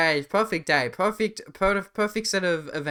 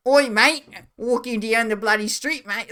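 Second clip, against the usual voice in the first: loud, high, hammed-up Aussie bloke; over-the-top Australian streamer voice; mock Australian accent; 7 semitones higher, 7 dB louder than usual. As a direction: ham up an Australian drawl on the street. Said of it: "doing it in Australia would be like".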